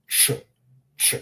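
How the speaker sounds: "ch" alone, tongue on the roof of the mouth; isolated "ch" with tongue to palate; hard Croatian ch. Shown as a demonstration. Teacher showing tongue placement, hard ch. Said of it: "tongues and put it to the top of our palate".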